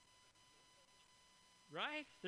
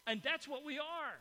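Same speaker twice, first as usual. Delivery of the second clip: emphatic declaration; 6 semitones higher, 6 dB louder than usual. Real, not acted. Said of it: "Did, did you hear that?".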